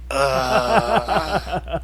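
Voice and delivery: high, loud groan of dismay. Real, not acted. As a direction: groan loudly at a hated character. Disgusted groan at Damian Wayne.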